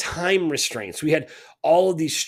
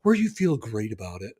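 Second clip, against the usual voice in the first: usual speaking voice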